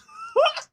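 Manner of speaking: high loud laugh; a sudden squeal of a laugh; burst of laughter. Acted. Sam laughing at the remaining joke count, high.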